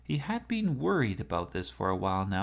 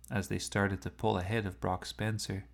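usual speaking voice

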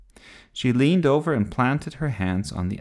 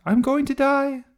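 high, loud death cry; melodramatic shout; 6 dB louder than usual. A narrator's reading. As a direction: wail out your doom at full pitch. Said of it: "he shouted".

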